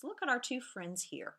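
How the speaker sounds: usual speaking voice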